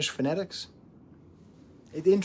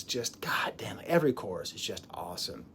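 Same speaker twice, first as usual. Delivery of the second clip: whisper; 6 semitones lower, 7 dB quieter than usual. Real, not acted.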